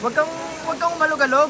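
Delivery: nervous plea. Real, not acted. Pleading on swaying bridge.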